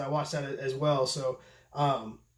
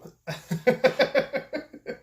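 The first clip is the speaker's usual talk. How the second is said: high laugh; 8 semitones higher than usual. Real, not acted. Laughs before toasting.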